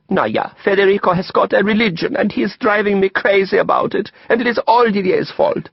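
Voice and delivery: put-on high, accented petulant voice; Ulla, sulky complaining woman. A narrator's reading. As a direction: complain and blame with a pout.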